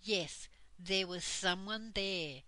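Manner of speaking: ordinary delivery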